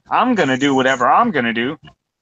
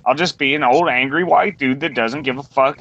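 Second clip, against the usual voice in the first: ordinary delivery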